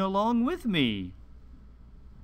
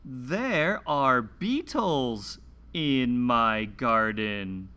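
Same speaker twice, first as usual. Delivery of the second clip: slow, clear picture-book read-aloud with long pauses; reading to children; slower than usual. Acted. Host reading a garden picture book to young children, slow and clear. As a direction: read each page slowly for little listeners.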